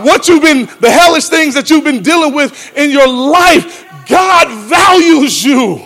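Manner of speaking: high, loud preaching build; preacher shouts that God recycles people; full-force preaching. Acted. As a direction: preach it up loudly.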